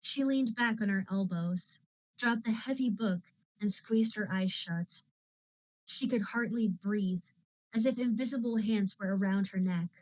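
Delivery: usual speaking voice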